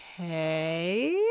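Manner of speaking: long, drawn-out okay sliding upward; skeptical okay. Acted. Lawyer hearing a sudden proposal in the cafeteria, dubious. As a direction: stretch it out doubtfully.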